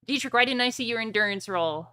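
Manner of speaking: ordinary delivery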